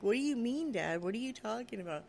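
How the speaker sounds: put-on puzzled kid wanting answers; baffled son. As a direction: imitate a boy questioning his dad. Son baffled by father's explanation.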